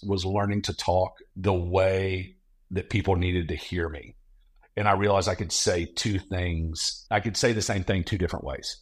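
ordinary talk, nothing marked

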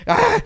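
a sharp high cry of rage; frustrated yell. Retold or imitated. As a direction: let out a furious yell. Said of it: "So I get pissed".

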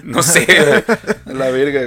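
giggling shrug; laughing. Real, not acted. Laughing mid-rant.

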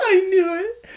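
high squealing laugh-cry; laughing mock cry. Mocking the twist, laughing.

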